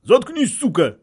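high, loud outburst; furious militant shout, pitched high; angry yell. A narrator's reading. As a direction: yell it to shut him up. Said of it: "заорали боевики".

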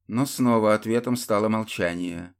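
plain reading voice

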